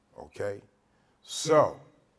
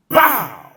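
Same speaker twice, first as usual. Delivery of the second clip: loud imitated whack; stick hit bow; 8 semitones higher, 14 dB louder than usual. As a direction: slam out the sound of the blow.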